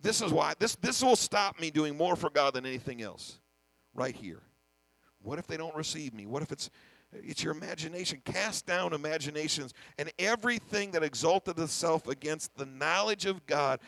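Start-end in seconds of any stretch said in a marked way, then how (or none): none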